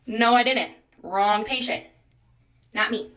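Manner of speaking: loud denial. Retold or imitated. Patient to doctor, denying the request, loud firm denial. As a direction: shut the claim down hard.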